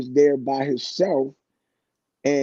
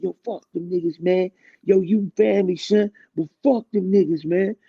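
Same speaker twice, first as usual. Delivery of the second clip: higher hyped-up voice; 5 semitones higher than usual. Retold or imitated. Retelling his own hype line.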